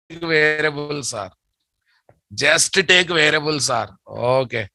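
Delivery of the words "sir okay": normal talking voice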